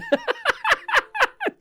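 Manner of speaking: sudden high laugh; high burst of laughter. Real, not acted. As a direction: crack up at the truce joke. Laughing at chat joke.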